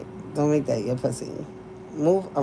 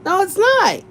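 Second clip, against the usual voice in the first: much higher, firm "No, it's not."; emphatic denial; 11 semitones higher than usual. Real, not acted. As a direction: jump up high to insist. Repeating her denial.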